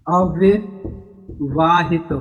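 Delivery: अविवाहित drawn out very slowly in separate parts; slow syllable breakdown. Shown as a demonstration. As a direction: break अविवाहित into slow pieces with a pause inside. Teacher breaks the term up slowly.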